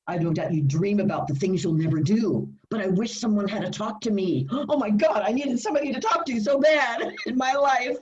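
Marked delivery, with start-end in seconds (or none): 4.5-8.0 a gasp, then higher and laughing with real emotion; emotional gasp. Real, not acted. Telling how the song hit her, gasping and laughing. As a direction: relive the relief, breathless.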